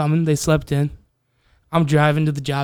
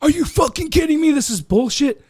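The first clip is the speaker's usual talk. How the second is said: outraged yell; 11 semitones higher than usual. Retold or imitated. Hothead blowing up at the coworkers who pranked him, mock outraged yell. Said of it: "I wasn't the guy that".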